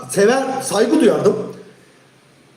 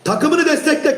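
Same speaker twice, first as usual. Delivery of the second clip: ordinary delivery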